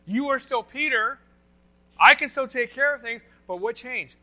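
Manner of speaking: loud, high, driving sermon voice; full-force preaching. Acted. Pastor to congregation, loud full-force preaching. Preaching peak about Peter's storm.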